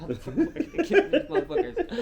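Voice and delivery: high, fast, loud mock-tearful threat; laughing mock outburst. Storyteller playing crying suitor, laughing and high. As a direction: squeal the threat through laughter.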